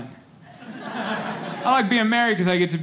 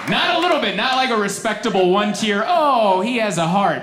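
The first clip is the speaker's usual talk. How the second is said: loud, high, breathless retelling of sobbing; half-shouted overwhelmed recounting; mock ugly crying tale; 8 semitones higher, 6 dB louder than usual. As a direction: blurt it out like the tears are pouring. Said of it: "I just started crying".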